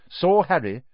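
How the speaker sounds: plain reading voice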